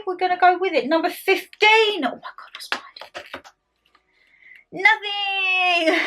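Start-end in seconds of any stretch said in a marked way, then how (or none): 2.1-3.5 breathless suspense whisper. Pretend. Whispered suspense opening a door. 4.7-6.1 laughing it out. Real, not acted. Laughs at the empty door.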